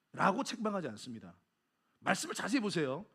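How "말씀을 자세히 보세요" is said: usual speaking voice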